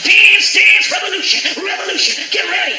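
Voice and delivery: loud, high, drawn-out hype voice; game announcer. Retold or imitated. Arcade game announcing itself, hype voice.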